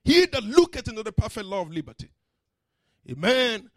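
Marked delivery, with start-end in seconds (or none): 0.0-2.1 preaching scripture. Acted. Chanted scripture recitation.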